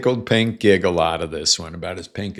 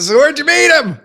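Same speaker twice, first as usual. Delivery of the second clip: much higher and louder, sing-song; teasing pointed question; 11 dB louder than usual. Retold or imitated. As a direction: needle her with the question. Quoting his pointed question.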